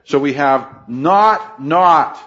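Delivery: "not" repeated, slow and hit hard; stress on not. Shown as a demonstration. Preacher showing the Greek double negative, pressing each "not". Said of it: "the Greek word for not added".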